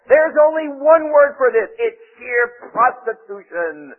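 slow, loud, high-pitched denunciation; quoted denunciation. Retold or imitated. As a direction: declaim it loudly, drawing out each word. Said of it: "he says".

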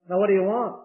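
ordinary talk, nothing marked

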